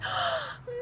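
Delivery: a sudden high gasp of recognition; startled joyful gasp, pitched very high; sharp gasp. Acted. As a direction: gasp as if you can't believe your eyes. Mary recognizes Jesus.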